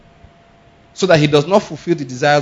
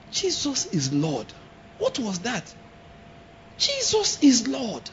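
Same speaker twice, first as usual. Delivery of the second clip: acted shock; 6 semitones higher, 10 dB quieter, slower than usual. Shown as a demonstration. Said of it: "If I have to explain".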